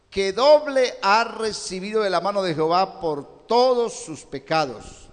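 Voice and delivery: normal talking voice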